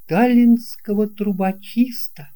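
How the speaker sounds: usual speaking voice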